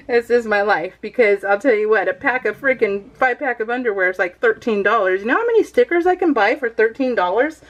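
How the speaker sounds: normal talking voice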